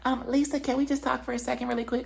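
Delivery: high, small-voiced imitation; mock timid request. Woman asking Lisa for a quick talk, high timid voice. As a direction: ask it high and lightly, tiptoeing in.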